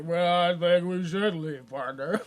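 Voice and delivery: put-on slow cowboy drawl in a Southern accent. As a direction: stretch it out like a hammy Southern cowpoke. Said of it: "he's getting real Southern with it".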